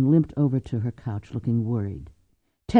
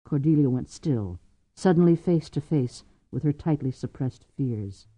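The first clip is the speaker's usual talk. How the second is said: ordinary delivery, nothing marked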